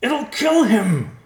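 high, loud, drawn-out scream; crying out. A narrator's reading. Riverpaw fears for his father's life, crying out. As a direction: cry out in terror for someone. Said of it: "Riverpaw cried".

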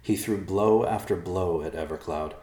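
ordinary delivery

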